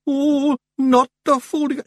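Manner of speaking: high, loud cry of dread; fearful Feegles. A narrator's reading. Feegles dreading the folding, fearful. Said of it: "looked around fearfully".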